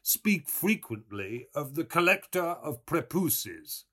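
ordinary delivery

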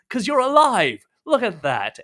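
high, loud burst of glee; delighted exclaim. Acted. Merchant marveling at the survivors, loud delighted exclaim. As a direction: throw your arms up in delight.

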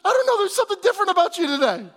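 high, loud, fast mock voice of the wife; wife puzzled. Pastor imitates his wife's puzzled reply, high and quick. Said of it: "She's like".

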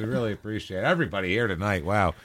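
soft, hushed show host thanking a star; mock gushing host. Host thanking a pretend celebrity cameo, soft mock gushing. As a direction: fake starstruck gratitude, starting high then dropping soft.

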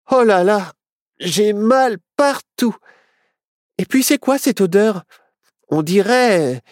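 high, drawn-out moaning; aching, grossed-out adventurer; complaining story character. Acted. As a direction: groan it out in a high, disgusted voice.

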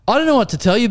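high, helpless mock protest; exasperated reply. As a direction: plead helplessly with a hopeless customer. Retold reply to mistaken customer.

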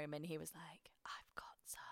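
secretive whisper; worried whisper. Real, not acted. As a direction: whisper it like a confession. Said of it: "why are we whispering like they can hear".

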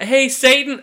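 high, loud, eager greeting; a lowly demon chirping up to his boss; eager subdemon. Acted. Subdemon hails Satan, high and loud.

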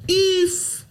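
very high, drawn-out squeal; demonstrated catchphrase squeal. Shown as a demonstration. Host shows friends' old running bit, high squeal. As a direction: let out one long high squeal. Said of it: "we just randomly used to do it, like".

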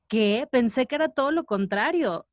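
usual speaking voice

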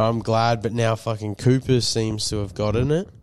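normal talking voice

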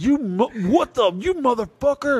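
high indignant outburst; mock outraged retort; angry kid. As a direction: call him out in disbelief, high. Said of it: "And I was so mad".

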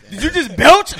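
loud, high shout through laughter; laughing outburst. Real, not acted. Podcast host catches his friend belching, loud laughing disbelief.

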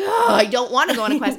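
hammed-up groaning refusal; mock whine. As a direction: whine it like someone dragged along. Retold whining refusal.

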